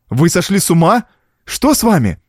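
high, urgent questioning; alarmed protest. A narrator's reading. Niece trying to free the girl, alarmed. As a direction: plead with him in alarm.

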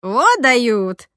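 loud, delighted marvelling; admiring. A narrator's reading. Roza watches the two guards wreck the door, admiring. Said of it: "восхищённо сказала Роза Васильевна".

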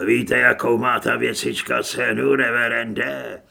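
loud, rasping thief voice; coarse crook, impressed. A narrator's reading. (hlasitý chraplavý lupič, znalecky)

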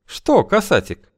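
high, sly woman's voice. Acted. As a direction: purr it, way up high. Feodosia's voice held.

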